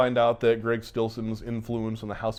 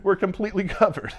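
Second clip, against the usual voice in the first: mock smug boast; 9 semitones higher, 5 dB louder than usual. Owner boasting, teller laughing.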